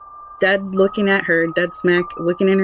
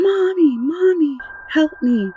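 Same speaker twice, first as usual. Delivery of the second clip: mock child scream; 9 semitones higher, slower than usual. Daughter crying for mommy in the dark, high mock scream. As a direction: voice the daughter calling out in the night. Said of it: "I hear her screaming".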